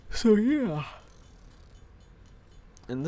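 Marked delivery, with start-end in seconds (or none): 0.1-0.8 voice crack. Real, not acted. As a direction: voice cracks up high and loud. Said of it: "hopefully not voice crack like that again".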